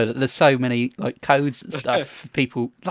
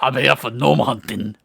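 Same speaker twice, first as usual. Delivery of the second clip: vaguely Scottish put-on accent; comic caller in a muddled, sort-of-Scottish accent; muddled, unplaceable accent. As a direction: put on a botched accent you can't place. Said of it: "I don't know what accent that was".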